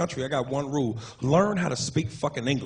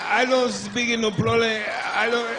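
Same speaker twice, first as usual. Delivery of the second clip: slow, high broken-English voice; mock broken English; 5 semitones higher, slower than usual. Cashier to comedian, slow high broken English. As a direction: drag out the broken English, higher. Comic voicing non-English speaker.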